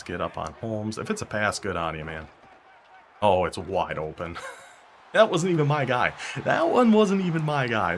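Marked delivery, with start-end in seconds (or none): 5.1-8.0 louder, higher protest; exasperated shout. Real, not acted. Gamer yelling at his own blown coverage, exasperated. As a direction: yell that it wasn't your fault. Defense beaten for touchdown.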